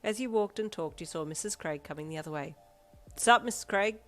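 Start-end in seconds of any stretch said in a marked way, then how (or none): 3.2-4.0 casual call. Acted. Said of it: "you called casually to her".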